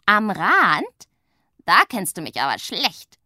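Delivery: loud mocking echo of am Rand; scornful shout. A narrator's reading. Witch scoffs at her sister's am Rand, loud and mocking. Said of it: "ruft Pumpernickel".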